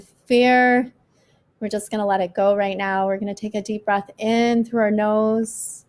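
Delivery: slow, calm breathing guide; guided meditation. Acted. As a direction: ease them through each breath.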